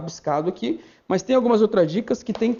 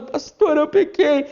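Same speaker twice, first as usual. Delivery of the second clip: mock crying plea; 8 semitones higher, 4 dB louder, slower than usual. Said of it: "o membro chorando".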